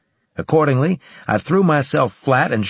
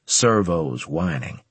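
ordinary delivery, nothing marked

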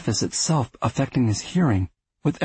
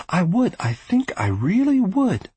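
high, earnest insistence; 8 semitones higher than usual. Acted. Arthur affirming to Louis.

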